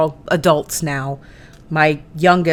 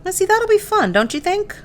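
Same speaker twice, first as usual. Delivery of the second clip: cutesy coo; 13 semitones higher, 7 dB quieter than usual. Real, not acted. Cooing over her craft piece.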